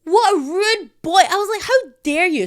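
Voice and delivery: high, loud offended shriek; hammed-up indignant yell; mock outraged scream. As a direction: scream it like you are deeply insulted. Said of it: "sorry if I just screamed into the mic".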